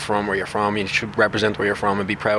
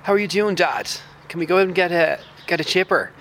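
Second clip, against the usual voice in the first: higher-pitched posh D four Dublin accent; 7 semitones higher than usual. Shown as a demonstration. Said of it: "the, the D four".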